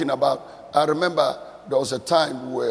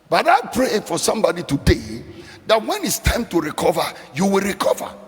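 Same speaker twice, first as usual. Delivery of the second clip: loud, very high climactic sermon cry; preaching at full force; 5 semitones higher, 4 dB louder than usual. Acted. Preacher praying over the congregation to recover, loud and very high. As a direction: build to a shouting preaching peak.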